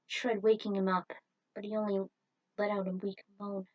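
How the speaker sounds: ordinary delivery, nothing marked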